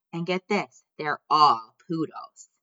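plain reading voice

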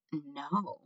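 hushed, soft refusal; whispered. A narrator's reading. Despero answering the head mouse, whispered. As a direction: say it softly, almost whispering. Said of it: "whispered Despero".

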